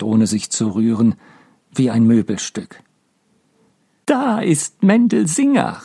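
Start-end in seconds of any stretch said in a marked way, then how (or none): 4.0-5.8 high, slow, strange cry across the room; shrill call. Acted. Miriam greeting her father from the sickbed, a much higher, unhinged cry. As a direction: call out to him like a madwoman. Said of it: "rief Miriam".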